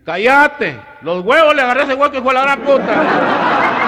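put-on high, loud, slowing old-man holler, cracking up by the end; yelling old rancher. Tio Chema hollering back at the man scolding him, high and loud. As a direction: bellow it back like a crude old country rancher.

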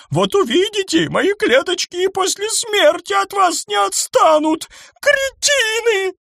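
high, loud, shrill panic; a rich lady screeching in despair; hysterical rich lady. A narrator's reading. Eleonora screams at the others about the bomb.

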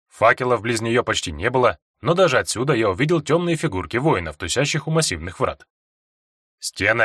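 usual speaking voice

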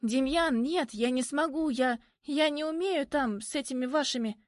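high, flustered refusal; panicked protest. A narrator's reading. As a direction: protest in alarm, stumbling over the words. Panicked refusal, interrupted by him.

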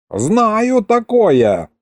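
high, drawn-out shouted reply; shouting. A narrator's reading. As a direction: call it down slowly from the wall. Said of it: "крикнул князь". (протяжный выкрик)

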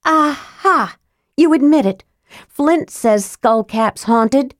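put-on high triumphant young woman; triumphant girl. A narrator's reading. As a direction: pounce on it, high and pleased. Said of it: "Tika returned".

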